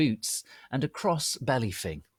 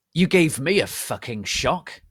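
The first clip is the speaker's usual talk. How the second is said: loud, higher startled yell; angry shout; 5 semitones higher, 8 dB louder than usual. A narrator's reading. Narrator startled by Hagrid, angry shout. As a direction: snap at the intruder. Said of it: "I shouted angrily".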